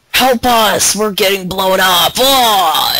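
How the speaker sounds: loud, high mock cries for help; acted-out battlefield panic; panicked trooper shouts. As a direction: yell like a soldier under fire, hammed-up. Acts out troopers under attack.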